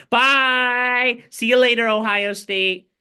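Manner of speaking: cheerful mocking send-off to the losing team; mock goodbye. Real, not acted. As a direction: wave the rival off the field with a gloat. Taunting farewell to rival.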